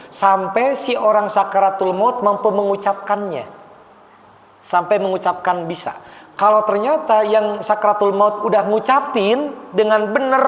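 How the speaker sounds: normal talking voice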